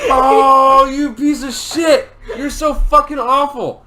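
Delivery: loud, high, indignant shout; pained cursing. Real, not acted. Man cursing the friend who plucked his hair, pained shout. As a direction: curse out the culprit through real pain.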